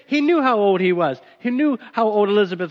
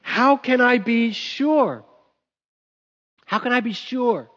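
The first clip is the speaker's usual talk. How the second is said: slow, higher, puzzled questioning; doubting Zechariah; 3 semitones higher, slower than usual. Retold or imitated. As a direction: wonder aloud, unsure and slow. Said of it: "he said".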